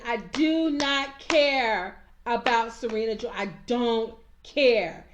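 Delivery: slow, loud, every word hit; angry emphasis. Real, not acted. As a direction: spell out I do not care, slowly and loudly. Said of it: "That just pissed me off to no end".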